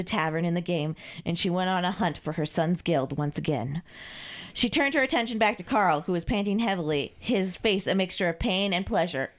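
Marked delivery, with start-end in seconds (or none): none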